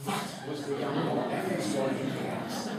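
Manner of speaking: ordinary talk, nothing marked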